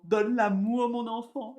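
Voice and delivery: loud, high Creole-accented imitation of an aunt. Aunt urging her niece to love, loud Creole accent. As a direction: belt it out like a Creole auntie. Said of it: "je reprends mon accent créole".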